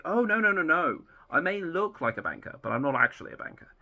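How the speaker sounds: high, quick flustered denial; a lightly acted Doctor, hurriedly protesting. The Doctor corrects the barman, flustered and high. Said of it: "And he's like".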